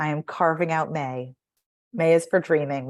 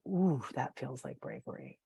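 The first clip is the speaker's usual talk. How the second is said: softer, lower, a touch breathless; quiet awe; 5 semitones lower, 10 dB quieter than usual. Retold or imitated. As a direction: marvel at it to yourself. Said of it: "I go".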